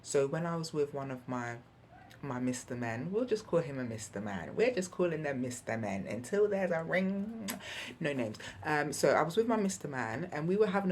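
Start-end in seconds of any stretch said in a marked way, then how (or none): none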